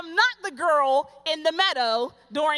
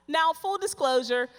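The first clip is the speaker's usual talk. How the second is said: ordinary delivery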